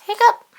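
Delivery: high, loud squeaky hiccup. Acted. Pete hiccups loudly in the story. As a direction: pop out a sudden hiccup.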